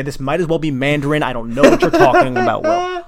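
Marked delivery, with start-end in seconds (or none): 1.6-3.0 laughing. Real, not acted. Baffled by poker question, laughing.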